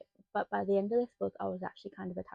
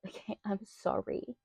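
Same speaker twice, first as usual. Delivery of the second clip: ordinary talk, nothing marked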